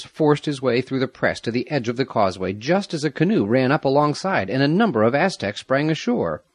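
plain reading voice

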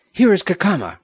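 loud, high cry from the storyteller. A narrator's reading. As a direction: call it out urgently to a rescuer. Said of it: "Roger cried".

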